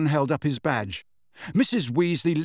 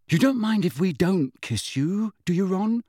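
higher-pitched mock worry; falsely anxious; 6 semitones higher than usual. A narrator's reading. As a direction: ask with put-on anxiety, pitched up. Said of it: "in a falsely anxious voice".

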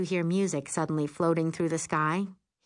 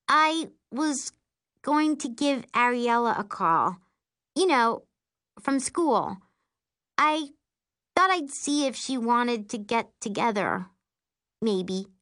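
higher, halting, tentative voice; uncertain; 6 semitones higher than usual. A narrator's reading. Sister shyly proposing to call a schoolmate, uncertain. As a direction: hesitate between phrases, tailing down at the end. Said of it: "eyes wide with uncertainty".

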